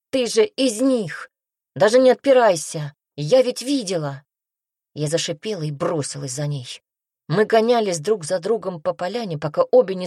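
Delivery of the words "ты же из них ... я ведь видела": high, loud accusing cry; raised voice, flinging out accusations; shouted accusation. A narrator's reading. Glenna accuses from a safe distance, shouting. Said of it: "и снова крикнула".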